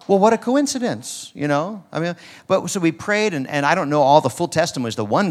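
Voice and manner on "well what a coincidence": high-pitched, tongue-in-cheek wonder; mock surprise. Preacher wryly remarking on four knee patients found, mock surprise. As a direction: play up the coincidence, pitched way up. Mock dismissal of healing story.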